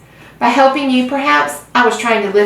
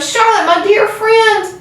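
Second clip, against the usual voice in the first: high, moaning wail of grief; a sobbing high moan; 13 semitones higher, slower than usual. A narrator's reading. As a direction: moan her name in agony. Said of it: "he moaned".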